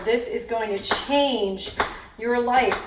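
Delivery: ordinary talk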